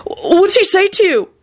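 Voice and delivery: high, halting, anxious question; flustered worry; stammered alarm. A narrator's reading. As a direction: stumble into the question, slowly. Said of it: "he stammered".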